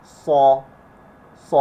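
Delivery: thick, full-mouthed emphatic S in "স"; heavy s. Shown as a demonstration. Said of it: "তিনোটাই মোটা আওয়াজ হবে". ("স" মোটা, মুখ ভরে উচ্চারণ)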